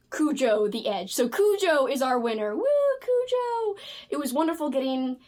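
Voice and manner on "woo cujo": high, drawn-out cheer. Real, not acted. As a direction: whoop for the winner. Celebrating the winner.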